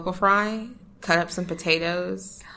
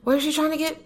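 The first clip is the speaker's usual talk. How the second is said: mock outrage; 10 semitones higher, much faster than usual. Real, not acted. Jealous about husband, mock outrage.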